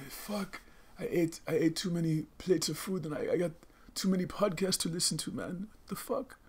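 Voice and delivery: high, soft put-on complaining voice; put-on changed accent. Overfed descendant moaning after five plates, high put-on accent. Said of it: "I don't know why my accent changed".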